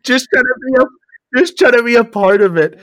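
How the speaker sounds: loud, high mock-Scottish voice; mock Scottish accent. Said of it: "standing backstage sometimes going".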